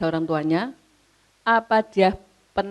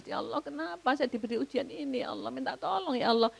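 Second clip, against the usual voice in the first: soft, higher-pitched mock-weeping prayer; tearful plea imitated; 4 semitones higher, 10 dB quieter, faster than usual. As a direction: plead quietly to God, as if crying. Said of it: "di situ nangis". (memohon pelan sambil pura-pura menangis)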